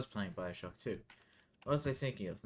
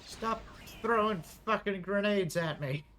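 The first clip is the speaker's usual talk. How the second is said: higher, loud annoyed shout; frustrated yell; 8 semitones higher, 4 dB louder than usual. Real, not acted. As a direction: yell at the enemies. Yelling at enemies in game.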